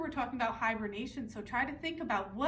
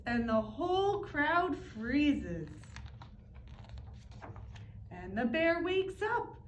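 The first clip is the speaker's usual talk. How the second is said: plain reading voice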